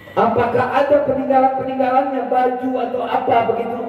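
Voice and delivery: high eager asking; high, yearning request; longing traveller. Acted. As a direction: beg for something of his.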